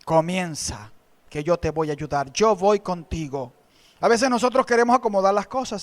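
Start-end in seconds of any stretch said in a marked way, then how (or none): 0.0-3.5 gentle voice of God. Retold or imitated. God encouraging the believer, lightly voiced low reassurance. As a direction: speak as a calm God promising help. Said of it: "Él responde y te dice".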